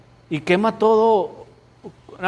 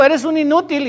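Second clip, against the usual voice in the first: harsh insult; 9 semitones higher than usual. Retold or imitated. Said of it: "Alguien te dijo".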